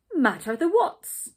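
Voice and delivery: loud, high topsy-turvy question; a lightly voiced muddled man asking; Mister Topsy-Turvy. A narrator's reading. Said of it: "He always spoke back to front".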